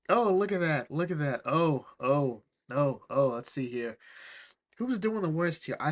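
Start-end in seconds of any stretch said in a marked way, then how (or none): none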